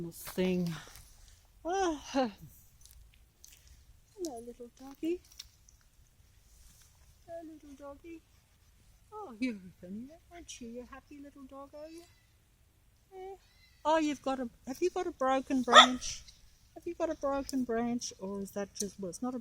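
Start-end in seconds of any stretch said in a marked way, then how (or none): 1.6-13.3 greeting a dog, high and hushed. Real, not acted. Baby talk to a dog. 13.8-18.1 high, playful question to the dog; higher, playful talk to a dog with a stick; pet talk. Real, not acted.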